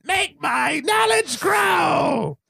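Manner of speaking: high, slow, hammed-up villain shout; Rita Repulsa villain cry. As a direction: bellow it like a cartoon sorceress raising her staff. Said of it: "much like Rita's staff".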